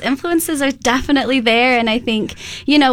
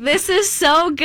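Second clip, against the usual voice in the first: impressed reaction; 6 semitones higher, slower than usual. Retold or imitated. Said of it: "and I was like".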